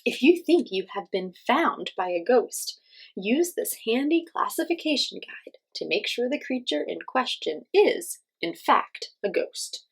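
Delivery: ordinary delivery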